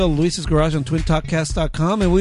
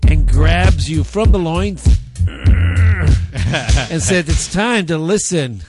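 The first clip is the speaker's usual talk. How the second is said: loud, theatrical segment intro; big radio hype voice; announcer; 6 dB louder than usual. Acted. Hypes the news segment.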